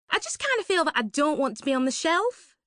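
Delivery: put-on high, breathy-bright posh young woman; a high, gushing party girl; Suzanne's gushing voice. To Laurie at the party, a high gushing voice.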